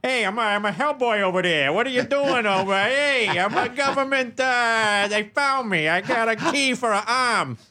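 lightly voiced Hellboy impression; a hint of a bad Hellboy take; Seth MacFarlane voice. Host mocks Seth MacFarlane playing Hellboy, lightly done. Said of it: "'cause Seth, it's terrible".

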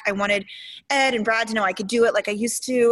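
ordinary talk

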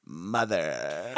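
very low, very soft haunting voice; a creepy dog voice; creepy ghost child voice. Pretend. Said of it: "we really don't speak as him except to go".

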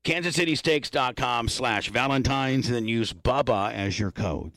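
slow ad read. Acted. Host reads sponsor plug.

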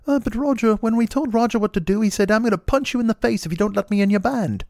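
high, reserved mock English voice. Guitarist recalling the singer's threat, high mock British voice. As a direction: do a bad, high-pitched British impression. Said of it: "It's a bad [name]".

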